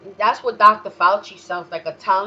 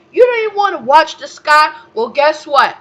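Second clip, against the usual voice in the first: higher, much louder jeer; taunting shout; 8 semitones higher, 11 dB louder than usual. Real, not acted. Taunting listeners.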